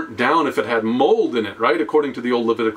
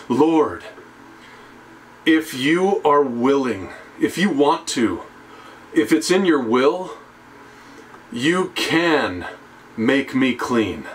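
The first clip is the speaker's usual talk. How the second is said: near tears; slower than usual. Retold or imitated. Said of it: "this man was probably near tears".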